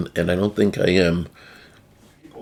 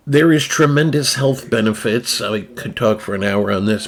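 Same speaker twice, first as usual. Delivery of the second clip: normal talking voice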